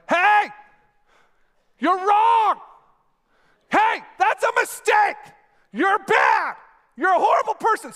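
high, loud, slow shouted accusations; harsh condemning yell. Shown as a demonstration. Preacher demoing shouted condemnation at Eli from afar, harsh yell. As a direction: hurl the blame at him from far away. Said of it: "And I'm like".

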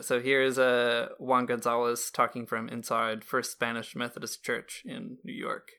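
normal talking voice

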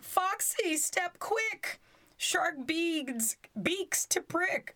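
crying for help. A narrator's reading. Drakestail calls his fox friend for help, high and urgent. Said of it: "Drakestail cried".

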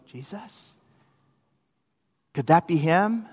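very soft, questioning; hushed, disbelieving question; hushed wondering. Acted. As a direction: barely voice it, as a tentative question. Disciples' hushed disbelief, acted.

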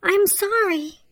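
high-pitched, urgent storybook cry. A narrator's reading. Cinderella must leave the prince, crying out. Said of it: "she cried".

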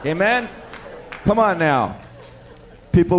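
usual speaking voice

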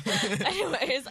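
high, loud laugh-laced word; drawn-out word through real laughter. Real, not acted. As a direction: pull yourself back through the giggles. Recovering from a laughing fit.

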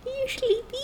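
high, soft cooing question; high baby-talk voice. Pretend. Friend cooing a question to the drowsy cat, high and soft. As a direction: coo it like asking a pet.

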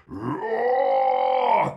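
loud, high roar; lion roar. Acted. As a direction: roar right up close. Lion closest.

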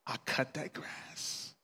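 hushed, slow near-whisper; quiet, broken submission; humbled resignation. Retold or imitated. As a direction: drop to a slow whisper in defeat. Humbled outcome of the story.

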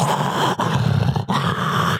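loud, heavy imitated breaths; mask breathing. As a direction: heave air noisily through a mask.

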